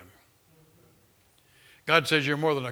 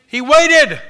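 waited cried out much higher and louder; shouted emphasis; 12 semitones higher, 15 dB louder than usual. Shown as a demonstration. Preacher driving home that David waited, shouting waited. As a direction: hit waited with a soaring shout. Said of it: "is because he waited".

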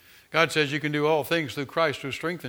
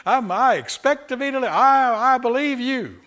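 higher, lightly voiced praise from the pit; voicing David praising; 9 semitones higher, 6 dB louder than usual. Preacher voicing David praising God from the pit, higher and lightly voiced. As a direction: cheerfully claim deliverance while stuck. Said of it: "he's still going".

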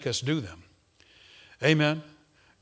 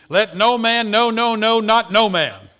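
every "no" hammered, louder and higher; stress on no; 6 semitones higher, 8 dB louder, slower than usual. Shown as a demonstration. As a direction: punch each "no" like a drumbeat. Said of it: "this is a double negative".

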